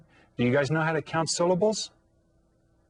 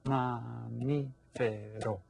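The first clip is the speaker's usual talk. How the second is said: "Mamífero" very slow and softer, four beats; syllable by syllable; 3 semitones lower, 8 dB quieter, much slower than usual. Shown as a demonstration. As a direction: softly count out ma, mí, fe, ro in "Mamífero". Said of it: "Has four syllables".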